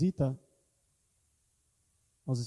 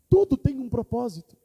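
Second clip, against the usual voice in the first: proclaimed louder; 6 semitones higher, 6 dB louder than usual. Acted. Preacher proclaims the point.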